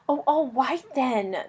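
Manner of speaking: slow, hushed, grudging dragon grumble; reluctant dragon, almost whispered; grumbling. Acted. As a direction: give in grudgingly under your breath. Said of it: "the dragon grumbled".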